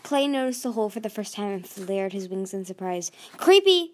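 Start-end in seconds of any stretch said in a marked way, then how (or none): 3.4-3.9 loud, high yelp of alarm; startled yelp. A narrator's reading. Clay noticing the hole for the first time, startled yelp. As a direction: yelp it out in surprise. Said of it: "he yelped".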